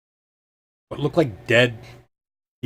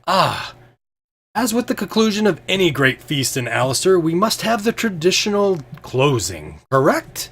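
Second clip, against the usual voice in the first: higher, formal feast-host announcement; lofty host voice, pitched up; grand Fabler; 8 semitones higher than usual. Acted. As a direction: proclaim the old tradition to the hall. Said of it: "he says".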